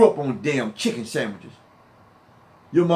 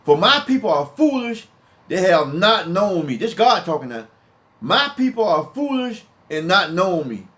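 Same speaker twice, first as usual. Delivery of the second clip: raised-voice sermon reading; heated preaching; 5 semitones higher than usual. Acted. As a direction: hammer each verse home. Preaching Hosea passage loudly.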